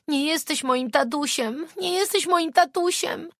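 put-on high, loud child's scream; little girl screaming at the man. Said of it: "krzyczała".

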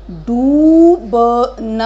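डूबना with a stretched long ऊ, slow and loud. Shown as a demonstration. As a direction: model डूबना slowly. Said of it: "मात्रा लगाएंगे ऊ की".

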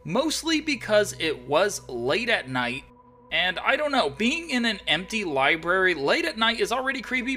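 loud, high, hyped recap; excited storytelling. Acted. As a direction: tell it big and breathless.

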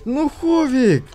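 high exasperated cry of a name. Real, not acted. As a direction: groan at a teammate. Teammate fails the play.

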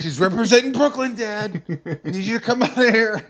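high, loud panicked yelling; mock scared kid, high and loud. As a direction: scream for Dad in hammed-up terror. Kid calling dad in panic.

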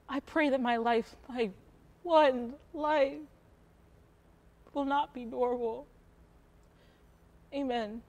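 soft, halting prayer with long pauses; hushed prayer. Acted. Mother praying for her one life, hushed and halting. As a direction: pray quietly, feeling out each phrase.

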